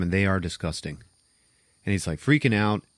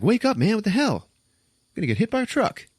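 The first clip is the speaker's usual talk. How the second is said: high-pitched, urgent acted warning; alarmed mock warning; 11 semitones higher, faster than usual. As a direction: act out snapping someone out of a daze. Voicing woman warning dazed man.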